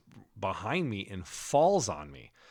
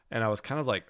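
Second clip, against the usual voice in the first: ordinary talk, nothing marked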